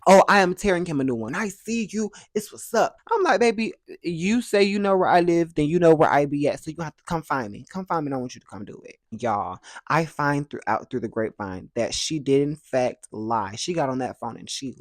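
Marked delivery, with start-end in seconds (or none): 0.1-9.0 loud, high daring challenge; defiant taunt. Retold or imitated. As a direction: dare him to come. Defiant dare to the uncle.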